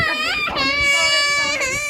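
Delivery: put-on mother squealing a playful biting threat at her toddler; squealy playful tease. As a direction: squeal it like you're about to nibble her.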